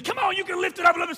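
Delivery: high, loud, quick urging; spotter's shout. Acted. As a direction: shout them on like a gym spotter. Acting a gym spotter urging lifter.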